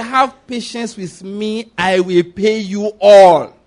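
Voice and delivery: very slow, stretched-out promise; drawn-out plea. Acted. Debtor in the parable begging for patience, slow pleading. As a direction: stretch each word, swelling on the last.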